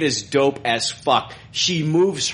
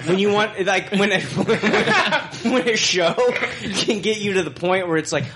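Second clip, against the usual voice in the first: talking through laughter, higher; giggling while making a point; laughing; 6 semitones higher than usual. Real, not acted. Host laughing through his point.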